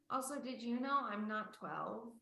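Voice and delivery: ordinary talk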